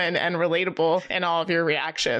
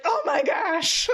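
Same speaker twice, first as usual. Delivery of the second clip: high, drawn-out, laughing excitement; thrilled squeal of recognition; delighted gasp-out; 10 semitones higher, much slower than usual. Retold or imitated. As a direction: gush it slowly with a laugh. Retells delight at the favorite song.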